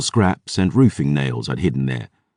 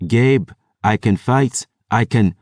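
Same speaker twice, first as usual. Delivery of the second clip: put-on Eladani accent; 6 semitones higher than usual. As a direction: plead to fight in a lightly done Eladani accent. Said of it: "in a passable Eladani accent".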